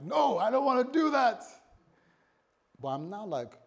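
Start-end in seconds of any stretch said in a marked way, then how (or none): 0.0-1.4 high, loud mock refusal; protesting outburst. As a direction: balk loudly, like a reluctant child of God. Acts out his protest to God.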